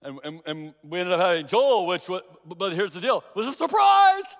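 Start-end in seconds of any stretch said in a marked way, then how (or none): 3.3-4.2 sudden high, loud exclamation; excited burst. Real, not acted. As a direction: burst out with the surprise. Announcing the surprise pregnancy.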